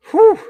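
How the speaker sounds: high, loud relieved "whew"; sharp whew. Real, not acted. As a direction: let out a loud, high whew at a close call. Reacts to free rusher near sack.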